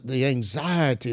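ordinary talk

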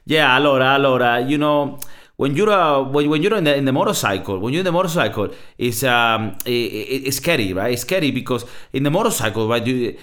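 put-on animated, lilting Italian-accented English. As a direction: imitate an Italian racer explaining himself in excited English. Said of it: "you gotta love the Italian English accent".

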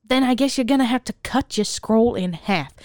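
normal talking voice

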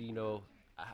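faint, off-mic voice; speaking too far from the mic; away from mic. Real, not acted. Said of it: "You gotta hold the mic closer".

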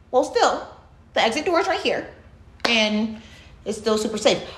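ordinary delivery

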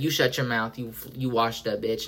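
low, quiet mock scold; a hushed diva putting a heckler down. [name] scolding the heckling fan, low and quiet. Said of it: "she said".

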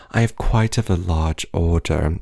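mock British customer; half British accent. Imitating customer ordering coffee, half British accent. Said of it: "like, half British accents".

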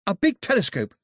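high-pitched eager request; eager islander. A narrator's reading. Pilou naming the gift he wants, eager. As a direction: ask for it in a high, keen voice.

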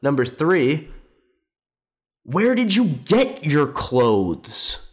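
high and slow, dragging each word; mock pompous question. Mocking the host's questions to a dead father, pompous. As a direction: ham up a smug lecturer listing questions. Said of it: "He goes, he goes".

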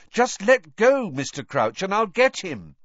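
loud, high, insistent plea; emphatic, agitated appeal. A narrator's reading. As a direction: press him firmly to let go. Boy trying to free himself.

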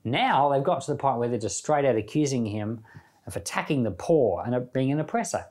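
ordinary talk

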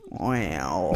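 very low, soft put-on voice; sorting hat's wow. Host acts the hat's reaction to McGonagall, deep soft voice. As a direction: drop deep and quiet as the hat. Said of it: "He goes".

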